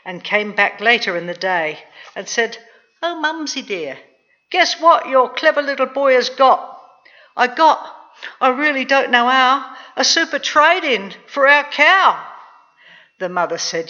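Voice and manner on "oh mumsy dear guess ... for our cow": put-on high, loud, eager little boy; pleased-with-himself child, pitched up; boastful boy. A narrator's reading. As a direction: crow proudly to your mum. Jack proudly boasts to mother.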